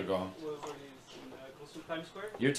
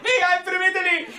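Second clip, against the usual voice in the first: "Me, I'm from Italy!" blurted very high and louder; eager Italian guy; 5 dB louder than usual. Retold or imitated. Comic imitating an Italian guy butting in, very high. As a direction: jump in thrilled and high. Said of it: "this one guy's like".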